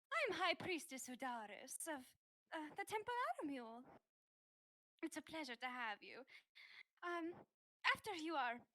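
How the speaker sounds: put-on priestess with a light German accent; hint of a German accent. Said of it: "I don't know why I'm German now".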